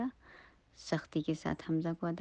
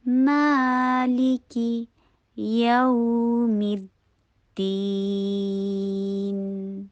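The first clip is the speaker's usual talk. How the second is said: louder, higher, very drawn-out chanting tone; sustained syllable-by-syllable Quran recitation; slow recitation; 4 semitones higher, 8 dB louder, much slower than usual. Shown as a demonstration. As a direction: recite slowly, holding each long vowel. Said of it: "long کیجیے آواز کو".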